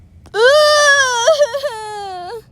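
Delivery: high squealing burst of laughter; shrieking laugh. Real, not acted. Shrieking laugh at own mistake.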